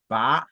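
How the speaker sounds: "pa" rising from low; second tone. Shown as a demonstration. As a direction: upward sweep on "pa". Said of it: "le deuxième ton".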